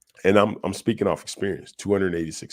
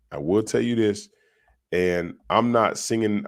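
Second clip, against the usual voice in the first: usual speaking voice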